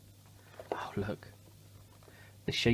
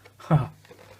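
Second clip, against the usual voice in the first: hearty laugh; 3 semitones higher, 9 dB louder than usual. Real, not acted. Laughing at the joke.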